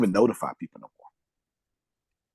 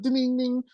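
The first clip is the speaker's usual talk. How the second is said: notification ding imitation; 14 semitones higher than usual. Mimicking a phone notification.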